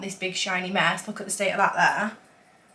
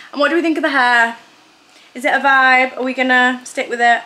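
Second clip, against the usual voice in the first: normal talking voice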